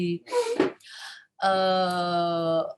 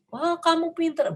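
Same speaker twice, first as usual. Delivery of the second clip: high, eager burst of praise; too-early praise; 9 semitones higher than usual. Shown as a demonstration. Parent praising a child before the task is done, high and eager. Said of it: "kita jangan berikan perhatian yang".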